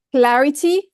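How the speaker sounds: usual speaking voice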